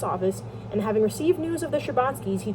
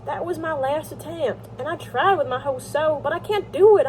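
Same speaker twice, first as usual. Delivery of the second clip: higher, mildly pleading character voice; lightly voiced Levin, defeated; 6 semitones higher than usual. Levin admits his last attempt failed, mildly despairing. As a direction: confess failure with a touch of drama.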